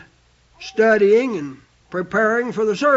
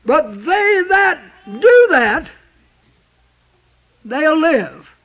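preaching shout; 4 semitones higher, 4 dB louder, slower than usual. Real, not acted. Preacher building to a climax.

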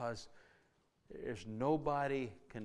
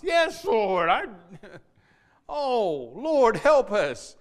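laughing; 10 semitones higher, 17 dB louder, much slower than usual. Acted. Laughing mock Jonah reply.

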